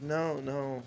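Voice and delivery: imitated sleepy protest; soft mock sleep-talk. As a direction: mumble it like someone refusing to wake. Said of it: "about to start sleep talking".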